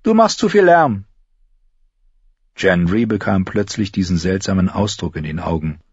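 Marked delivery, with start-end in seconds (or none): 0.0-1.1 contradicting. A narrator's reading. Arya contradicts Gendry, insistent. Arya's character voice.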